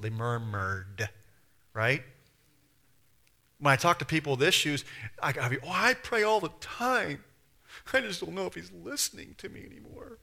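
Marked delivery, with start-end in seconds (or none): 5.6-10.2 high mock-pitiful complaint; self-pitying whine. Preacher imitating people with issues who pray, whining. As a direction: whine it like a poor baby. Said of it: "Poor baby".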